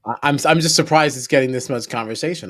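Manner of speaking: ordinary talk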